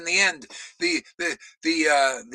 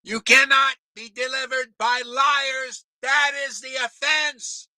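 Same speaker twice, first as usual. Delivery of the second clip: yelling; 6 semitones higher than usual. Real, not acted. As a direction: hammer it home, shouting. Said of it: "Do I have to yell more?".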